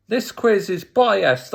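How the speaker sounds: re-enacted grievance, slightly heated; indignant. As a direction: protest it like a sore loser. Retells own protest at quiz.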